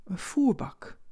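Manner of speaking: ordinary delivery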